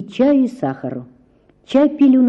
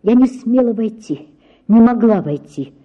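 usual speaking voice

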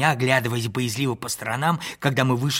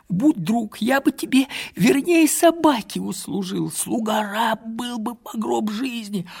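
put-on higher, earnest old servant begging; higher, imploring old wanderer; pleading old man; 7 semitones higher than usual. A narrator's reading. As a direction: beg him like a loyal dog. Old man begs to be taken.

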